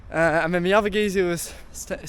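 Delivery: ordinary delivery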